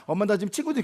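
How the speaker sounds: ordinary talk, nothing marked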